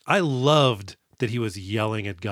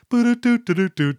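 high, loud sung Mario death tune; Mario death jingle; 11 semitones higher, 6 dB louder than usual. Retold or imitated. Hackman falling like Mario losing a life, with a high sung death jingle. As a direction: chirp the game-over jingle. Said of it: "like Mario".

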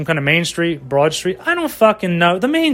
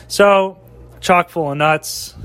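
usual speaking voice

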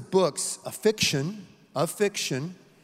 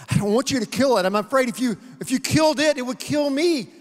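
much higher and louder, fearful plea; a ghost afraid that losing the lizard will kill him; frightened ghost; 6 semitones higher, 6 dB louder, faster than usual. Acted.

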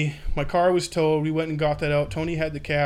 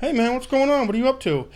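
overly cheerful greeting; 8 semitones higher than usual. Retold or imitated. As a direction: act super casual to hide trouble. Calling his father from jail.

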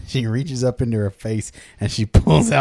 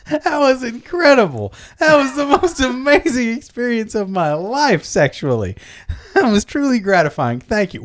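high-pitched, overwhelmed raving; awestruck gratitude; 8 semitones higher than usual. Acted. Satisfied customer thanking the madam, hammed-up awe. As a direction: gush as if your life just changed.

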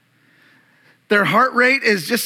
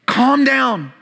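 firm rebuke; 4 semitones higher, slower than usual. Retold or imitated. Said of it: "he's saying that to some of us".

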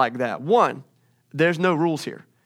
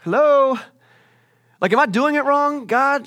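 high, loud frustrated call upward; exasperated prayer; 10 semitones higher, 5 dB louder than usual. Retold or imitated. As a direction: call out to God, fed up and unsure. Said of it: "I'm just like".

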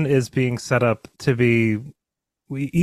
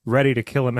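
normal talking voice

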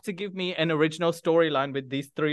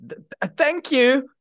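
stammered thanks; 10 semitones higher, 5 dB louder, slower than usual. Real, not acted. Host reacts to cheeky answer.

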